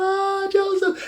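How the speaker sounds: very high, slow, soft mock weeping; drawn-out teary sigh of his name; tearful reunion. Brothers hugging and crying.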